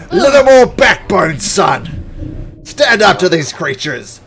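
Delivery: high, very loud commanding shout; shouted rebuke. Acted. Captain to his timid soldier, loud scolding shout. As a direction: holler it like a captain rallying troops.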